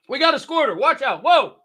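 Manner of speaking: high, loud startled cry; surprised yelp. Real, not acted. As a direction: react to a sudden spill. Drink squirts everywhere.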